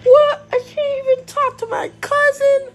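high-pitched, loud outraged whine; mad. Pretend. Kid unable to talk to a cousin, mad. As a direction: wail it in indignant frustration. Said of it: "I'm just so mad right now".

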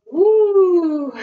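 loud upbeat whoop; cheerful woo. Real, not acted. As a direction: cheer the class on. Workout cheer mid-exercise.

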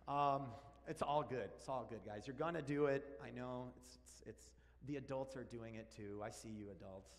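ordinary talk

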